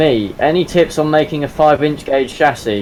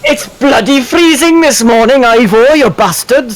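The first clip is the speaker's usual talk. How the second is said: put-on much higher, louder Welsh accent; 10 semitones higher, 3 dB louder than usual. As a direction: yell it with a broad Welsh lilt. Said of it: "me actually trying to be Welsh".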